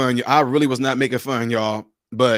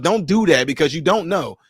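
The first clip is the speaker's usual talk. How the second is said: ordinary talk, nothing marked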